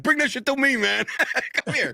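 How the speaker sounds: high, mock-furious challenge; Pedro Serrano. Daring the fans in the upper seats, mock challenge. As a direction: dare them to come over, hammed-up. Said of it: "almost pulled, like, a Pedro Serrano".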